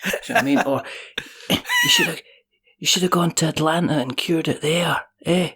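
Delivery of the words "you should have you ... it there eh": put-on light Scottish accent, high start. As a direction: do a broad Scottish voice. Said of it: "the pure Scottishness".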